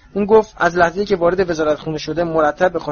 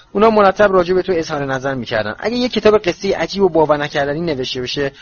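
fast, rushed retelling to get it over with; rushed telling. Acted. Hermione rushes to tell Harry the nasty press stories fast. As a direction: hurry through the unpleasant news. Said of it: "هرماینی خیلی سریع شروع کرد به تعریف کردن".

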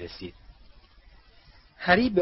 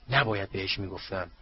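ordinary delivery

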